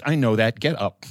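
low, firm mother voice; stern mother. Retold or imitated. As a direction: flatly order him up. Mother flat low order.

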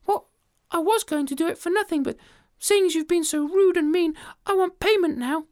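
very high, huffy demand; a small creature standing its ground; squeaky indignant ant; offended tiny ant. Acted.

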